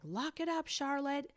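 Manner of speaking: high mock drill-instructor shout; imitated instructor yell. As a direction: order it like a tough karate teacher. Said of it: "he's screaming at your kids".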